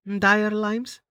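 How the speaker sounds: baffled repeat of an odd phrase; incredulous echo. A narrator's reading. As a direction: repeat "Dire limes?" with a doubtful upward lift.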